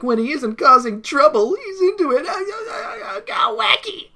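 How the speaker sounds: very high squeaky cartoon voice with ah-ah barks; wacky cartoon seal. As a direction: play a goofy cartoon seal. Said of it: "it's just kinda like".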